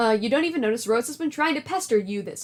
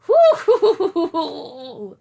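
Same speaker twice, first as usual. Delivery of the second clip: gleeful whoop; 4 semitones higher, 7 dB louder than usual. Acted. Nana flies off laughing.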